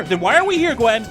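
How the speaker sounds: ordinary talk, nothing marked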